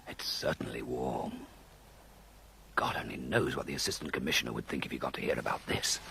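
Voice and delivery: hushed whisper, low and rushed; whispered aside. Acted. Man murmuring to himself beside the sleeping woman, whispered. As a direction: breathe it to yourself so she won't wake.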